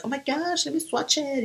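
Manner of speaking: high, eager squeal of delight; bubbly new makeup thrill; excited. Retold or imitated. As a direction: gush over the new buy. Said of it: "I was like".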